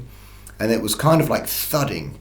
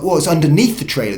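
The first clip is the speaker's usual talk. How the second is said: higher and louder, a jolt of fright; startled alarm; 6 semitones higher, 6 dB louder than usual. Retold or imitated. Reliving hearing the thing under his trailer, startled and alarmed. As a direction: blurt it out as the bang hits below.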